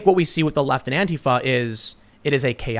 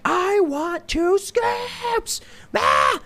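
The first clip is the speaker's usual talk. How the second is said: put-on very high, drawn-out tantrum voice of a child; screaming kid; much slower than usual. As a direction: mimic a kid screaming for what he wants. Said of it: "the kid's screaming and pounding his feet".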